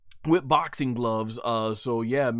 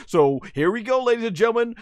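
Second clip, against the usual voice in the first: rising, high ringmaster call; announcer; 10 semitones higher, 6 dB louder, faster than usual. Pretend. Host presenting the fight to viewers, excited announcer. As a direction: hype up the crowd for the main event.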